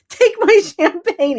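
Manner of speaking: high, loud, slow, laughing; laughing offer. Retold or imitated. Giving the doctor champagne, laughing. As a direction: push the gift with a laugh.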